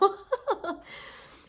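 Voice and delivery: high breathy burst of laughter; real giggle. Real, not acted. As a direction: laugh at yourself. Laughs at using her phone.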